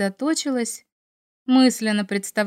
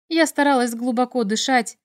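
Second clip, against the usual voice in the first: plain reading voice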